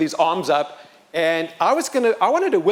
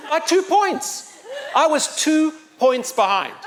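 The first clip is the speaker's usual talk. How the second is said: high, drawn-out comic complaint; hammed-up sore loser, pitched high; mock indignation; 10 semitones higher, slower than usual. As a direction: stretch each word in pretend outrage. Mock complaint at losing the trophy.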